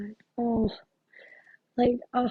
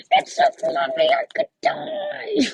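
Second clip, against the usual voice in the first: put-on very high, squealing little girl gushing over a toy; Agnes voice; 4 dB louder than usual. Imitating a little cartoon girl for the sitter, very high and squealy. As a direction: squeak it out like a tiny girl who just has to have it. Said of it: "Is that the It's So Fluffy girl?".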